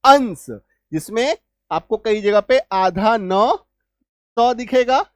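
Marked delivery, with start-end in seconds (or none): 0.0-0.5 अंश higher and louder, one syllable ending in s; blended ending demo. Shown as a demonstration. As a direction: punch out अंश as the answer. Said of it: "इसका उच्चारण क्या आएगा". (ज़ोर से अंश का उच्चारण)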